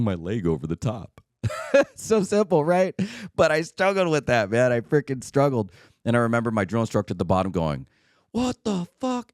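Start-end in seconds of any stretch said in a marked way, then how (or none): 1.4-1.9 a quick high burst of laughter; short high laugh. Real, not acted. Laughing at his own clumsiness over the wall, a short high laugh. 8.3-9.3 much higher, slow, drawn-out disbelief; drill instructor. Retold or imitated. Drill instructor at the wall's bottom, drawn-out high disbelief.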